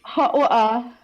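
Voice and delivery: put-on hot water said in a clipped English accent, wɔta; hot water with a dropped r, like an Englishman. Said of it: "could [name] say hot water a lot, please?".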